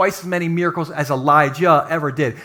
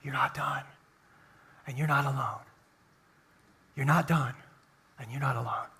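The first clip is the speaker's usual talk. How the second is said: much softer, hushed and intimate; whisper; 4 semitones lower, 12 dB quieter than usual. Shown as a demonstration. Pastor showing God whispering into hearts, hushed. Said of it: "he just whispers that".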